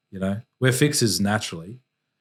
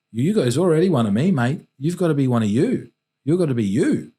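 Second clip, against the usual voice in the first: louder and higher, pressing the point home; emphatic fatherly urging; 6 semitones higher, 6 dB louder than usual. Retold or imitated. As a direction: drive it home to your son, raised voice. Dad urging son in retold story.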